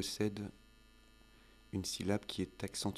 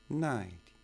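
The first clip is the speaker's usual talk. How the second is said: "Ninety" stressed on the first syllable, NINE-ty, higher and louder; word stress; 4 semitones higher, 5 dB louder than usual. Shown as a demonstration. Teacher contrasting with nineteen, NINE-ty stressed on first syllable. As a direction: hit NINE hard in "Ninety". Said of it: "l'accent tonique en anglais".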